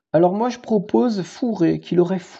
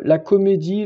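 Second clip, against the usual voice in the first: normal talking voice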